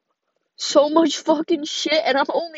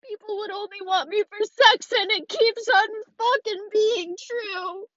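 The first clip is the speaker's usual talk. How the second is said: tearful, higher-pitched self-reproach; real crying; 5 semitones higher than usual. Real, not acted. Young mother asking why she can't love herself, crying. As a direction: plead with yourself through tears. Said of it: "I sound like a fucking crybaby right now".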